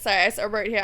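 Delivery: normal talking voice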